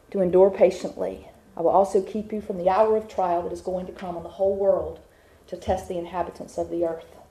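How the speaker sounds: normal talking voice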